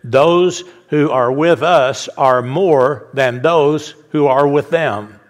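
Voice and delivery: slow, deliberate prophetic line; slow and grave, like a prophet reassuring his servant; solemn, weighty line. Acted. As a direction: lean into every word slowly. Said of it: "listen to this".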